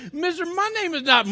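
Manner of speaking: put-on high little-girl voice near giggles; acted girl fighting laughter; laughing girl. A narrator's reading. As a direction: protest in a high, tickled voice. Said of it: "Martha Tom started laughing".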